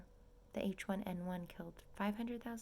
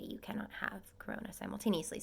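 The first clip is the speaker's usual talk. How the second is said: ordinary delivery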